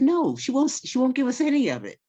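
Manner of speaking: put-on a child insisting to grandma; whiny kid. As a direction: plead your case like a sulky child. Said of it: "I said".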